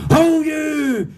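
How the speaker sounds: high, drawn-out challenge; hammed-up aggressive shout; mock battle yell. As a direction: holler it comically at charging men. Retold yelling at men with spears.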